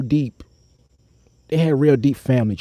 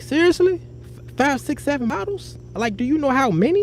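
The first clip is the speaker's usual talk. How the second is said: disbelief; 11 semitones higher than usual. Retold or imitated. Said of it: "I'm like".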